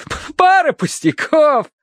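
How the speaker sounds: very high, slow, stammered boast; triumphant. A narrator's reading. Terence boasts of his easy climb, triumphant. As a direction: crow over the success with a stutter. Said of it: "торжествуя возвестил он".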